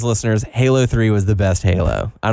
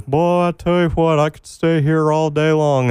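higher old Texan cartoon voice; Tom Anderson; 5 semitones higher than usual. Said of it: "favorite quotes from Tom Anderson".

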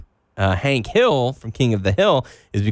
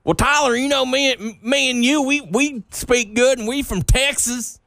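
exaggerated Southern accent; 13 semitones higher than usual. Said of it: "I don't talk like that".

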